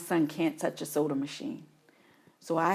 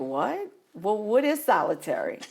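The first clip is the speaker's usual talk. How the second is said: baffled; 5 semitones higher, 5 dB louder than usual. Retold or imitated. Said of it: "I was like".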